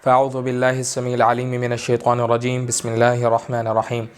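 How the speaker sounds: measured chant of the opening blessing; Quranic recitation. Real, not acted. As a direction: recite the ta'awwudh and bismillah. Opening recitation before lesson.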